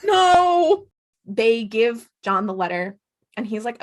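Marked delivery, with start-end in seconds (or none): 0.1-0.9 mock horrified cry. Real, not acted. Co-host echoes the outcry.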